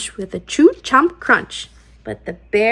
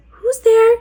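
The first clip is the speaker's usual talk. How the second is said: high, louder startled mouse; mouse crying out; 10 semitones higher, 4 dB louder than usual. Acted. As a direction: squeak it out in alarm. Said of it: "Mouse cries".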